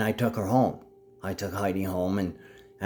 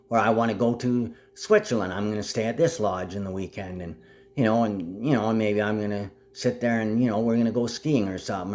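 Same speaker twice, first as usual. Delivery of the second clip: usual speaking voice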